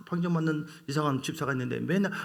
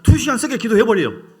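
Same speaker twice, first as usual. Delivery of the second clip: 두 시간 세게 기도해버려요 shouted higher and louder; 7 semitones higher, 11 dB louder than usual. Shown as a demonstration. Preacher shouting to the congregation to draw out an amen. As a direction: hammer it out to get an amen. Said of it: "아, 미안합니다".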